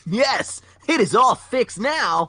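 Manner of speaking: high, slow hero voice; Sonic voice. Acted. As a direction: raise it up and drag it out. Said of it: "said Sonic".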